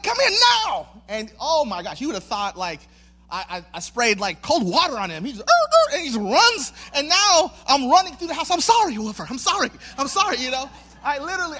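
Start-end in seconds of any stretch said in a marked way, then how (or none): 0.0-0.9 high, loud, exasperated dog command; retold burst of frustration; frustrated shout. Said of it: "just, like, frustration". 5.4-5.9 very high, loud imitated dog yelps; imitated squeal of a sprayed dog; puppy yelp. 8.5-10.4 high, urgent repeated apologizing; acted-out remorseful pleading; frantic apology. Acts out frantic apology while chasing.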